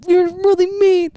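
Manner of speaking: high, slurred crybaby imitation; mock him whimpering through tears; mock tearful. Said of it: "this guy started tearing up".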